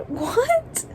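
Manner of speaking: high, disbelieving what; stunned disbelief at the twist; shocked. Real, not acted. Plot twist while reading.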